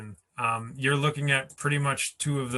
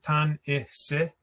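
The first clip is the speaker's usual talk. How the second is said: Tan, I, se as three separate, raised beats; split into syllables; 3 semitones higher, 4 dB louder, slower than usual. Shown as a demonstration. Teacher splitting the word for learners, syllable by syllable. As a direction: pull the word apart into Tan, I, se. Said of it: "I should split it like this".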